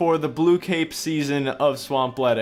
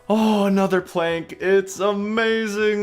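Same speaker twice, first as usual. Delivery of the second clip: excited; 6 semitones higher, 3 dB louder, slower than usual. Real, not acted. Excited over a new plank.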